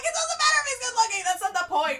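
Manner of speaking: high, loud mock shout; a retold, hammed-up defense; defensive outburst. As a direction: defend your idol hotly. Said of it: "I'm like".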